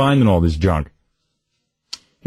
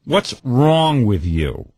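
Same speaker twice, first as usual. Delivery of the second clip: very slow, drawn-out reproach; nagging mother; much slower than usual. Retold or imitated. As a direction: imitate a mother rubbing it in. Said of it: "she has to rub it in".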